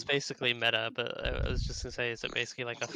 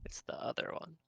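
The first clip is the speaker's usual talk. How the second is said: whisper; 4 semitones higher, 8 dB quieter than usual. Real, not acted. Dreading guess, whispered aside.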